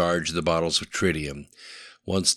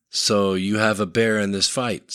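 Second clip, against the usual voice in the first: plain reading voice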